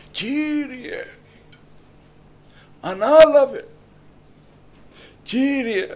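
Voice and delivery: high, loud cry to the Lord; shouted plea. Acted. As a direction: call out to God with all your strength. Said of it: "έρχεται ο Βαρτίμεος και φωνάζει".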